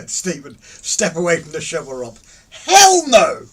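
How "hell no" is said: very loud, high refusal; high-pitched yelled denial; emphatic denial. Real, not acted. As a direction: shout it out in protest. Emphatic denial to teasing question.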